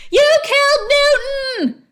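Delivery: loud, high accusing wail; very high outraged shout; anguished cry. A narrator's reading. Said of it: "cried Stink".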